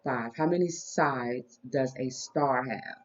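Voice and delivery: put-on soft, slow voice for a small child; reading to a toddler. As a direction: count gently with a toddler. Teaching toddler shapes.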